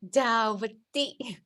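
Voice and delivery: DAVATI said very slowly, syllable by syllable; pronunciation attempt. Shown as a demonstration. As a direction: draw out "Davati" as da-va-ti, vowel after the v. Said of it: "How to pronounce?".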